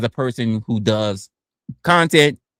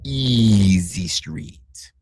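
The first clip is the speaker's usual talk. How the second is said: very slow, drawn-out and low; slow drawl; 6 semitones lower, much slower than usual. Pretend. Streamer savoring how easy chatters are, very slow. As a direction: stretch every sound out lazily.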